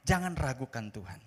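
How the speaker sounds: normal talking voice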